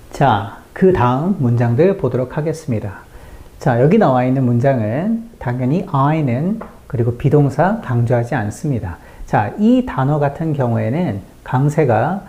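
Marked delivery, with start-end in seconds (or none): none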